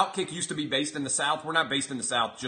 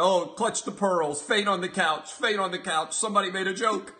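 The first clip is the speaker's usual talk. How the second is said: mock a fainting prude, higher; mock pearl-clutcher; 6 semitones higher than usual. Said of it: "I sound like an Italian man now".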